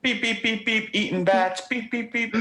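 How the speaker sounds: high loud beeping like Morse code; spoken morse code. Pretend. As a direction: beep it out like a telegraph. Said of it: "your channel would sound like Morse code".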